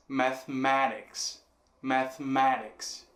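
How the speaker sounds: "mathematics" slowly, with a strong "ma"; stress on ma. Shown as a demonstration. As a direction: hit the "ma" of "mathematics" deliberately. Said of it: "ma sendo a forte". ("mathematics" devagar, com "ma" como sílaba forte)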